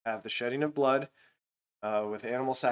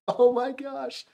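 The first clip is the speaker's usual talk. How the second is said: mock disbelief; 12 semitones higher, 6 dB louder, much slower than usual. Real, not acted. Disbelief at the golden calf.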